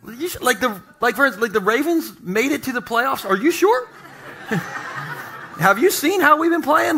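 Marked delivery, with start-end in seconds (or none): 0.1-0.4 squeaky disbelief. Retold or imitated. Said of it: "You're like". 3.3-7.0 mock disbelief. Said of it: "mock Ravens fan disbelief".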